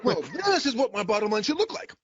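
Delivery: high, dry fussy accountant voice; fifties accountant. Retold or imitated. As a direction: recite numbers like an old-time accountant. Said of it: "imitation of a, uh, of an accountant from the fifties".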